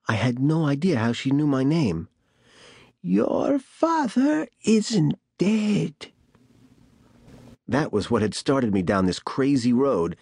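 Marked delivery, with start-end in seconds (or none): 3.0-6.1 put-on eerie old woman voice. A narrator's reading. Bat Lady's ominous line.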